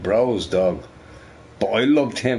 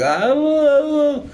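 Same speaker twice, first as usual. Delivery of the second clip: high, loud sustained dog howl; imitated dog crying; 5 dB louder, much slower than usual. Owner retells dog's crying, high imitated howl. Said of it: "he's, like, crying".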